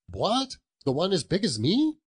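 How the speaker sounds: put-on high, quick boyish question; astonished boy. The boy answers Scrooge about the turkey, astonished. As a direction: sound amazed at the very idea.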